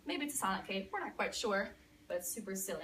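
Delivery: ordinary delivery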